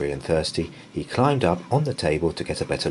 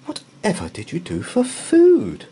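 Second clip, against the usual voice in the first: high, louder amazed question; surprised exclaim; 6 dB louder than usual. A narrator's reading. Mrs Brown to the bear, amazed and exclaiming. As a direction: gasp it out in disbelief. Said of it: "exclaimed Missus Brown".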